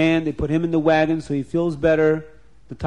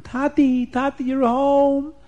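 put-on high, eager child calling out; excited child voice; 10 semitones higher, slower than usual. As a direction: call out like a happy little kid. Children greet returning father.

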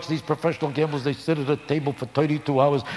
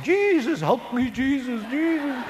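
very high, wailing pleas to Jesus; mock hollering prayer; 12 semitones higher, slower than usual. Gamblers begging Jesus at the table, high mock wail. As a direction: cry out like a desperate gambler. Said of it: "the Gentiles are always hollering".

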